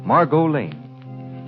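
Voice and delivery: normal talking voice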